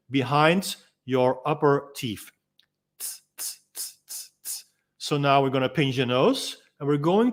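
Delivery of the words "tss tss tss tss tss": "tss" as soft unvoiced tongue-tip clicks; whispered T sounds. Shown as a demonstration. Instructor demos the T tongue position to divers, soft unvoiced "tss". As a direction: breathe out "tss, tss" with the tongue tip on the upper teeth. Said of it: "If you're pronouncing the letter T".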